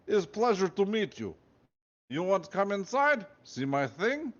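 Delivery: friendly guard in a put-on Russian accent. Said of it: "He had a thick Russian accent".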